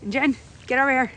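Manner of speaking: fast, loud call to a friend; urgent excited call. Real, not acted. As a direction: hurry her over. Calling friend over.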